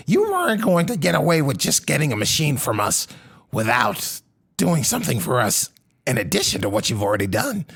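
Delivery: high, fast nasal-free squeaky creature babble; a twitchy little gatekeeper, fast; goblin voice. Acted. Gatekeeper creature talks again.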